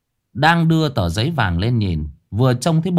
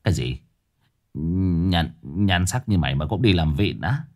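lower-pitched, stumbling disbelief; incredulous old exorcist; 5 semitones lower than usual. Acted. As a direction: question the ghost in low-voiced scorn. (giọng ông già trầm, ngỡ ngàng chê bai)

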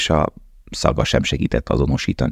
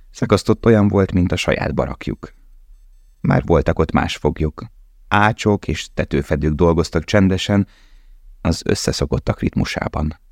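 usual speaking voice

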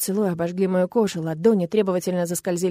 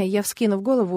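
ordinary delivery, nothing marked

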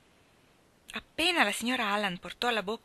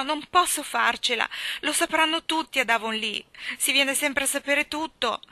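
put-on higher, louder child voice in melodramatic woe; despairing little girl, lamenting; 5 semitones higher, 5 dB louder than usual. A narrator's reading. Child confesses her disgrace and pleads with guardian, despairing. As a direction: wail out the catastrophe as an overwrought child.

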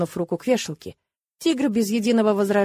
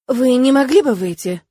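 higher, louder exasperated request; fed-up polite demand; losing patience; 4 semitones higher, 6 dB louder than usual. Acted. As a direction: blurt it out, unable to hold back. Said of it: "не выдержала я".

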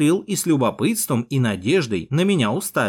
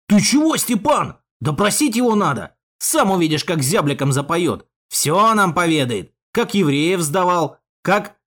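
high, indignant objection to an elder; heated protest; 6 semitones higher, 3 dB louder than usual. Acted. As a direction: protest hotly and push for questioning. Henchman protests, demands an interrogation.

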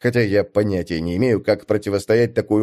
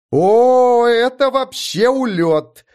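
high, loud, slow sinister delight; sinister glee; 12 semitones higher, 4 dB louder, much slower than usual. Acted. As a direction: gloat with a sinister grin. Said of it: "зловеще улыбнулась Эста".

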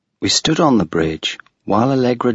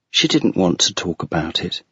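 usual speaking voice

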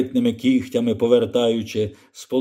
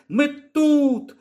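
put-on high girl's call; 11 semitones higher than usual. Lyra tells the old man where she is, high call. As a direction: shout that you are here. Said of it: "гукнула Ліра".

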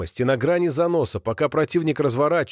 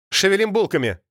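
barked order; 6 semitones higher than usual. A narrator's reading. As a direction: hurry everyone out of the truck. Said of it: "приказал Роджер".

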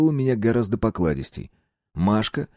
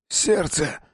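strained cry of pain; having a heart attack; 8 semitones higher than usual. A narrator's reading. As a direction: gasp it out clutching the chest. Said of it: "Он застонал".

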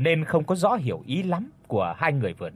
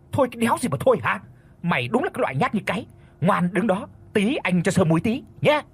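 fast, higher-pitched scolding; bullying rebuke; 7 semitones higher, faster than usual. A narrator's reading. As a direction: browbeat a timid friend. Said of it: "Vương quay lên nạt lại Toàn".

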